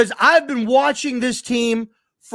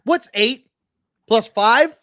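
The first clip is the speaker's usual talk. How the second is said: ordinary delivery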